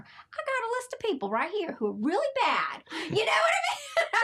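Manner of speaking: high, loud, joking tirade; hammed-up outrage rising toward a shriek; mock angry rant. Mother retelling her anger at God, joking mock rant. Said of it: "I was so mad".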